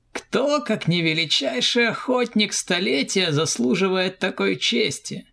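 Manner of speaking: high, sneering praise; mocking comrade. A narrator's reading. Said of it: "проговорил он".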